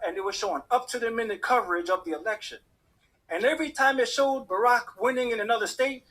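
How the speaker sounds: normal talking voice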